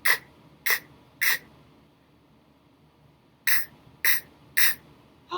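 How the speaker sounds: crisp separate k k k; repeated k sound. Shown as a demonstration. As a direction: tap out the k sound over and over. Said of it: "What sound did Leo leave off".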